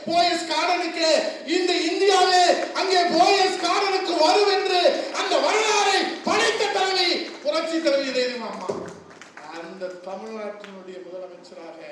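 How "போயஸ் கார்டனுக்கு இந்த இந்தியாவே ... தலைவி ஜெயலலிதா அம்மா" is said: shouted, high-pitched stage speech; fiery rally oratory. Acted. Politician roaring to party workers at the rally. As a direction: thunder it to the crowd.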